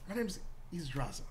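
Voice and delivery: hesitant, lightly acted tiefling woman; a touch of flustered introduction; lightly voiced tiefling. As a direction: start high, settle while giving the name. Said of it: "Tiefling, she goes".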